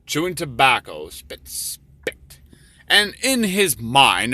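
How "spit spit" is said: voiceless spitting noise; whispered spit sound. Retold or imitated. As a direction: breathe out a mock spit twice. Tobacco-chewing clerk spitting.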